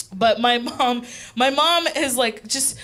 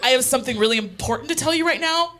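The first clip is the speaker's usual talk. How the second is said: mom's serious lecture; 4 dB quieter than usual. Retold or imitated. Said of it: "this is a real reenactment of her reaction".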